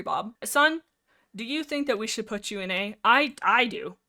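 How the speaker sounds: usual speaking voice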